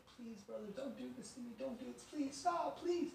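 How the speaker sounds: high, quiet desperate begging; pleading. Acted. Joseph begs his brothers not to sell him, pleading. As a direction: beg in a small, climbing voice. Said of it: "he begged and pleaded".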